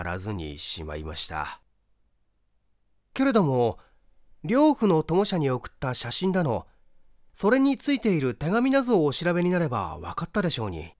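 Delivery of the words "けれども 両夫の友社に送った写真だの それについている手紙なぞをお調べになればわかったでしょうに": higher, insistent voice driving the point home, quicker at the end; higher insistent reproach, speeding up; pressing the point. Acted. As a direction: press him, as if clinching an argument. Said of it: "ダメを押すように言った".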